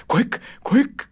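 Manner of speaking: high, loud, frantic ghost cry; urgent cry. A narrator's reading. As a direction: cry out in a panic. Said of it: "cried the ghost".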